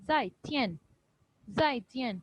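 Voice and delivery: "zai tian" short, each syllable falling; no stretch. Shown as a demonstration. Teacher contrasts it with hao, no stretch. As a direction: say zai tian quickly, dropping on each, unstretched. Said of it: "कोई stress या stretch नहीं कर रही".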